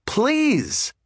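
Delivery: as if the answer is too obvious to ask; playful "please". Real, not acted. Eager yes to every invitation.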